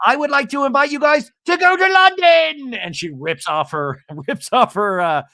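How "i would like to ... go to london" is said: high, loud put-on hostess voice; hammed-up party announcement; grand Shannon invite. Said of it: "Shannon at her tea party".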